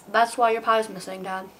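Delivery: ordinary delivery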